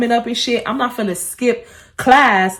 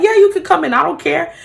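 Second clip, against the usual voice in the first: mock careless boss; 7 semitones higher than usual. Quoting boss's casual okay.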